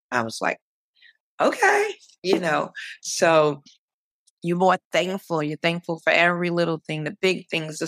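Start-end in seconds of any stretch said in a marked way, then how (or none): 1.4-1.9 much higher, drawn-up okay; retold reply pitched way up. Patient answering her doctor's orders, high-pitched okay.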